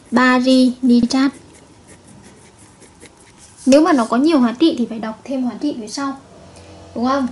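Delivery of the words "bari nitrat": bari nitrat read out slowly, syllable by syllable; slow model reading. Shown as a demonstration. Chemistry teacher slowly modelling the name for students. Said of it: "Vậy các em sẽ đọc là".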